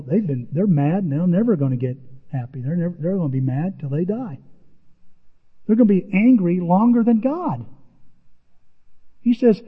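normal talking voice

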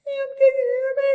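very high, thin distant wail; mock far-off voice. Doctor calling a patient going under, very high mock-distant voice. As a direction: squeal it as if fading away down a tunnel. Doctor heard through deepening anaesthesia.

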